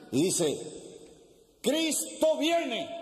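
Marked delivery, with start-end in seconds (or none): 1.6-2.9 high, long-drawn preacher's cry; street preacher. Acted. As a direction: proclaim it slowly across the park. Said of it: "Un predicador está en un parque predicando".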